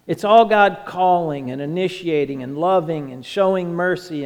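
loud, driving sermon delivery; preaching at full force. Acted. Preacher proclaiming to the congregation, loud and driving. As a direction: push every line out to the congregation.

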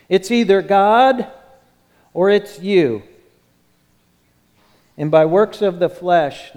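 loud, ringing ultimatum; forceful preaching. Acted. Preacher confronting the congregation, loud and ringing. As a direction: hammer the choice home.

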